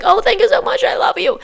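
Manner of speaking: high, gushing thanks; lightly voiced chirpy shopper; mock gushing. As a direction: gush it like a grateful shopper. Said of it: "and you're like".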